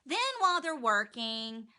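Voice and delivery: ordinary delivery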